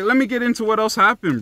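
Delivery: ordinary talk, nothing marked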